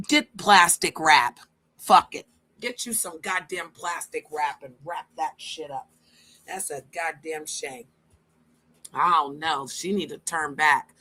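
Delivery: ordinary delivery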